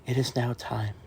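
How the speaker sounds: hushed, low golf-broadcast introduction; serious announcer voice. Pretend. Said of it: "serious voice here going".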